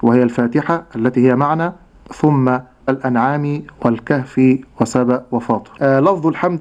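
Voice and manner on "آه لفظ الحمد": ordinary talk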